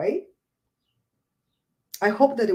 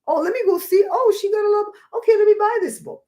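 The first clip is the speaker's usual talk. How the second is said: much higher, louder eager buyer voice; excited shopper; 12 semitones higher, 4 dB louder than usual. Pretend. Vlogger acting out online shoppers, high and excited. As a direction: get more thrilled on each line. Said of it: "people are gonna go".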